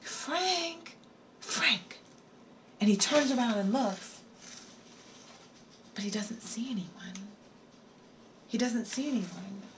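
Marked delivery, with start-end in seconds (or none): 0.0-2.0 high, drawn-out eerie call of his name; high, haunting call from a ghost girl; ghostly girl calling. Acted. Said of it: "Then he hears from behind him". 6.0-9.6 whisper. Acted. Tense moment in ghost story.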